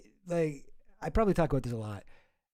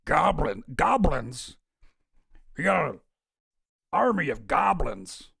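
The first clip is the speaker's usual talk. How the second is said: goblins with the B hit hard, a touch louder; Alex Jones impression; 4 dB louder, slower than usual. Streamer mimicking Alex Jones for viewers, hard B on goblins. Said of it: "he hits the B in goblins so hard".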